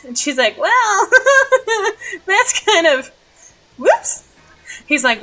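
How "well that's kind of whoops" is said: high, laughing fluster; embarrassed giggle. Retold or imitated. She learns he heard her drunk singing, embarrassed laughing. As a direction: giggle through an awkward admission.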